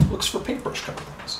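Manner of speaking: soft unvoiced whisper, like a nature-show aside; mock whisper. Vlogger jokes about hunting her brush cover, whispering. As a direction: breathe it as a hushed joke caption. Mock narrator caption of herself.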